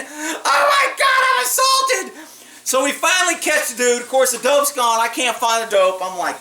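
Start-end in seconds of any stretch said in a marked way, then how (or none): 0.0-2.2 mocking falsetto wail; mock crying girl. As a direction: ham up a melodramatic victim. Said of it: "She's crying".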